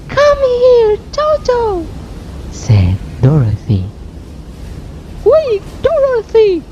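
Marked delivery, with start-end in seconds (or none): 0.1-1.8 put-on very high little-girl voice calling her dog. Dorothy calls her dog. 5.2-6.6 screamed. Acted. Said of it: "she screamed".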